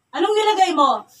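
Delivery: loud, high interrogating question; angry demand. Real, not acted. Interrogation, repeats question louder. (pasigaw na pagtatanong)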